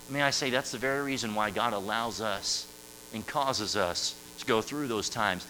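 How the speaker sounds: ordinary talk, nothing marked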